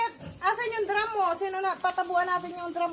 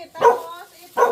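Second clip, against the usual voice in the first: louder, pointing out the next item; loud calling; 13 dB louder, slower than usual. Real, not acted. Showing items loudly to father. (malakas na pagtawag)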